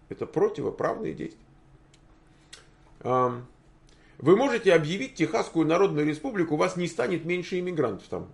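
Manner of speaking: usual speaking voice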